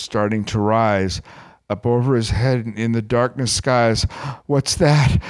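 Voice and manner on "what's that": snortled. A narrator's reading. King spotting the moon above him, mild snort. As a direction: snort it as a startled challenge.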